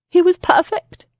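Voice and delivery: high, loud insistent mother; cling to her dead son's memory; grieving mother. A narrator's reading.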